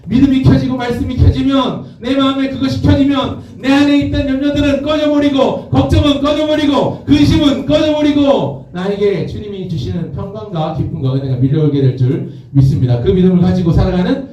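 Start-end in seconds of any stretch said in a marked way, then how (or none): 0.1-9.3 high, sustained proclamation; fervent preacher at the climax; full-force preaching. Real, not acted. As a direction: drive each clause home at a high pitch. Climax of the sermon.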